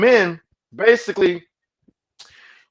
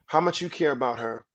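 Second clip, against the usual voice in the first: normal talking voice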